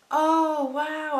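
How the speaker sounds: high, loud burst of amazement; retold excitement. Reading out her message to Phoebe, gushingly amazed.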